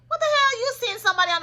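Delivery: sudden high, loud disbelief; real gasp-like what the hell; shocked outburst. Real, not acted. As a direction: blurt it out scandalized. Shocked at reality show news.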